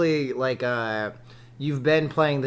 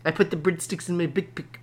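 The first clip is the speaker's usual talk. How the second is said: South African accent; 4 dB quieter than usual. Shown as a demonstration. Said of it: "South African accent".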